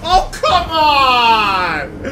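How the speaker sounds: loud, slow, anguished yelling; frustrated shout. Real, not acted. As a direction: wail at the screen in frustration. Character dies in game.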